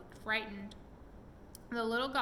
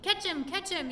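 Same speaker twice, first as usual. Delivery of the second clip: much higher and louder urgent yell; 8 semitones higher, 5 dB louder than usual. A narrator's reading. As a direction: yell for help catching the goblin. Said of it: "yelled Chingy".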